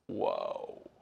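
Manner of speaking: whispered, stunned wonder; barely voiced amazement; hushed awe. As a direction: let it out softly, as if awestruck. Awed reaction to the story.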